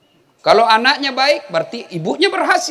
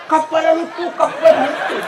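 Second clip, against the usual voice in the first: put-on kids shouting in Bugis; 6 semitones higher than usual. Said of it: "Bahasa Bugis".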